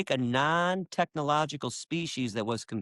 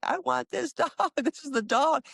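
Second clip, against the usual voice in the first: much higher-pitched, delighted exclamation; happy child's excitement; 14 semitones higher than usual. Retold or imitated. Remembering picking Shadow at the pet shop, happy and excited. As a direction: relive a thrilled kid choosing a dog. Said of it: "how happy I was".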